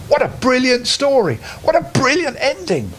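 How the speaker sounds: high, bright praise; enthusiastic. Real, not acted. Parent praising the bedtime story, enthusiastic. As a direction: gush about it. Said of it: "enthusing about story".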